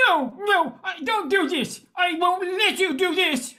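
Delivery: high, frightened protest; panicked refusal. Acted. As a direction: push back in rising panic. Man refuses losing his brain.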